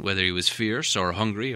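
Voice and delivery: plain reading voice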